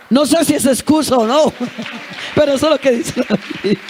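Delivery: laughing while excusing herself; real laughter over an excuse. Real, not acted. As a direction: giggle through the excuse.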